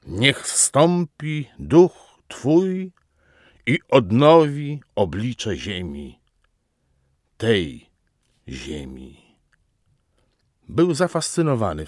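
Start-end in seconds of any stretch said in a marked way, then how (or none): 0.1-2.9 higher, very slow invocation, word by word; rising, drawn-out papal cry; intensified invocation. A narrator's reading. Pope's invocation repeated with more force. 3.7-9.2 grave oration ending hushed and deliberate; solemn papal plea. A narrator's reading. Ends the Pope's proclamation.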